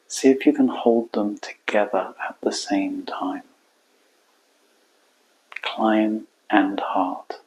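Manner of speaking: quiet, spacious meditation voice; guided meditation. Acted. Coach guiding listeners to hold client and heart, quiet meditation. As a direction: let each phrase settle into a long silence.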